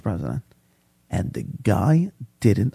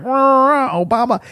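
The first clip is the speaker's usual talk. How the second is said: much higher squeaky gibberish rant; mock babble; 12 semitones higher than usual. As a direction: parody a man who won't stop talking. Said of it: "He was like".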